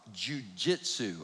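jujitsu drawn out slowly, syllable by syllable; said real slow. Shown as a demonstration. Preacher slowing on jujitsu for the congregation. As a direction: spell out jujitsu slowly so it lands. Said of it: "I gotta say that word real slow".

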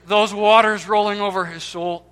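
loud, impassioned sermon delivery; forceful preaching. Real, not acted.

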